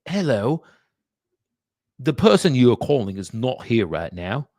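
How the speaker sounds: put-on recorded greeting in a wobbly English-Australian accent; English accent slipping Australian. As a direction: imitate an English voicemail prompt, drifting Australian. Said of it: "Well, that's Australian. W-, this was English".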